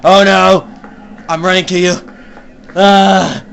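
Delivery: loud, high alarmed cry; panicked shout. Real, not acted. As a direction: yell it out in panic. Panicked rush in horror game.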